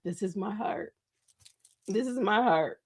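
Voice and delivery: very soft, recovering from crying; quiet and heartfelt through tears; real tearful voice. Real, not acted. Host baring the heart to followers, tearful and hushed. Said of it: "I'm crying literally live".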